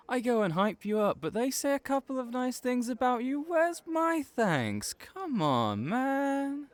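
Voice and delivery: high, fast, hammed-up outrage; mock indignation. Friend fishing for thanks after hyping him up, mock outrage. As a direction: play at being offended for laughs.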